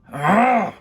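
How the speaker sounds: loud, high angry bellow; furious roar. A narrator's reading. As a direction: roar with rage. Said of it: "Count Olaf roared".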